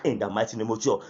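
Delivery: ordinary delivery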